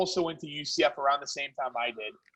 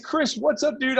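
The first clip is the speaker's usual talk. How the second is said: higher-pitched, chummy retold greeting; feigned familiarity; 7 semitones higher, 3 dB louder than usual. Caller greets supposed classmate Chris, feigned familiarity. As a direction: act like his old college buddy. Feigning old college friendship on call.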